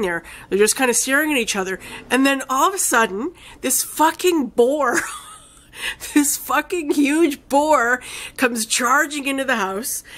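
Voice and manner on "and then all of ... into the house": excited buildup. Real, not acted. Sudden climax of retold film.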